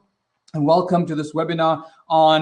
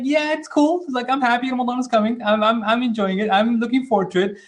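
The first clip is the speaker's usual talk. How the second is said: much higher-pitched, easygoing happy voice; happy, upbeat; 7 semitones higher than usual. Acted. Teacher voicing people happy Ramadan is coming, happy and upbeat. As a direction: imitate someone cheerfully looking forward, pitched up. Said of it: "who are kinda happy".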